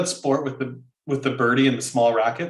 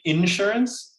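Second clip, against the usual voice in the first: INSURANCE hit hard on the first syllable, higher; stress on IN; 7 semitones higher, slower than usual. Retold or imitated. Co-host mocks people who say it so, front-stressed. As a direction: punch the IN of INSURANCE. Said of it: "why do you put so much emphasis on the in".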